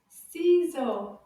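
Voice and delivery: Ciseaux slow and loud, both syllables drawn out; loud, measured Ciseaux. Shown as a demonstration. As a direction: stretch Ciseaux for learners to copy. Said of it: "Now a little bit faster".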